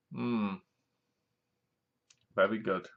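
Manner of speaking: soft, low mock approval; underwhelmed reaction. Viewer watching the car blow up, underwhelmed reaction. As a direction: murmur faint praise, plainly unimpressed.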